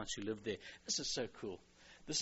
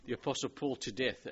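ordinary talk, nothing marked

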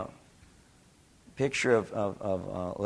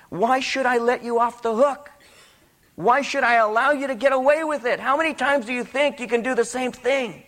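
high, challenging string of questions; impassioned preaching; 10 semitones higher, 4 dB louder than usual. Acted. Preacher voicing the Lord confronting sinners, impassioned. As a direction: fire the questions out like a fervent preacher.

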